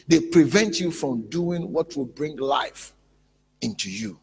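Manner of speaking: loud, high preacher's warning; forceful preaching. Real, not acted. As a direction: drive the warning home to the congregation.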